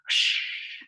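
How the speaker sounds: drawn-out unvoiced hiss; snake hiss. Pippi imitating the snake for the class, a long hiss. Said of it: "then he hissed".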